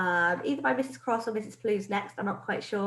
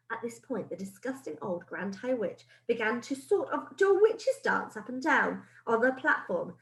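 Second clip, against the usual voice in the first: plain reading voice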